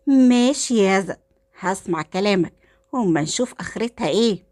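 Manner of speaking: ordinary delivery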